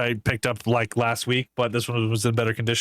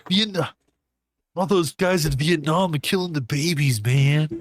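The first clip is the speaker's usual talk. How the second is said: slow, stoned surfer dude; 3 semitones higher, slower than usual. Retold or imitated. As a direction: drag it out like a laid-back surfer. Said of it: "you gotta say it like a surfer".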